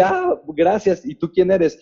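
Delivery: lightly voiced visitor asking who you are; mildly imitated guest; a hint of puzzled American guest. John's reply, voiced.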